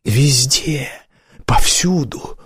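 urgent half-whisper, broken by pauses; hot loud whisper. A narrator's reading. Woman pointing out the dragon everywhere, hot half-whisper. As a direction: breathe each word out hotly, place by place. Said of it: "прошептала она горячо и громко".

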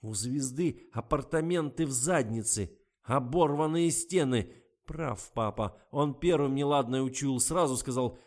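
furious rant. A narrator's reading. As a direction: pour out his humiliation in fury. Garik rants at his girlfriend.

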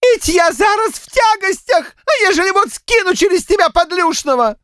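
high, howling village woman's lament; wailing. A narrator's reading. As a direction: wail the complaint in a very high voice. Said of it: "с подвывом заголосила". (голосит с подвывом, высоко)

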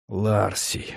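soft exhaled name; breathed out. Acted. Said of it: "выдохнул Аскаль".

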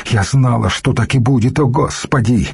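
usual speaking voice

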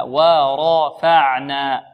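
ورفعنا slow with a heavy emphatic راء; slow tajweed model. Shown as a demonstration. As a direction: draw out ورفعنا, full heavy ر.

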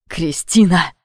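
furious yell cutting her off; angry shout. Acted. David shouts her name. (гневный окрик)

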